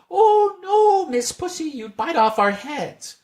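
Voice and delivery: very high, loud mouse squeal; squeaky mice. A narrator's reading. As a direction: squeak it back at the cat. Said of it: "little mice".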